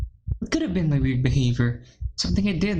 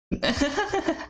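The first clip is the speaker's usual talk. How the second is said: incredulous laugh; 11 semitones higher than usual. Real, not acted. Streamer laughs at script.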